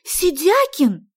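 high, loud, drawn-out Сидякин; indignant exclamation. A narrator's reading. Sonya lost for words at Vasya, indignant "Сидякин!". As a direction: cry out Сидякин in outrage. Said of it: "От возмущения".

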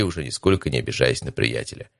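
ordinary delivery

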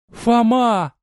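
high call of his name from afar; calling out shyly but high; timid call. A narrator's reading. Girl timidly calling to Foma across the water, high call. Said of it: "услышал он несмелый окрик".

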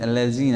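الذين said alazin with a z in place of the dh; الذين said as alazin, the mistake shown. Shown as a demonstration. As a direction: swap the dh in الذين for a z sound. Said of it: "أبدلت الذال بالزاي".

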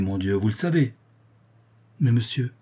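ordinary delivery, nothing marked

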